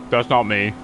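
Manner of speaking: usual speaking voice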